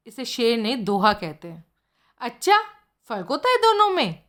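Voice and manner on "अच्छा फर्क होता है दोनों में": much higher, wide-eyed question; naive surprise. Acted. Friend asks wide-eyed and high-pitched if there's a difference. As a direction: ask it innocently, pitched up high.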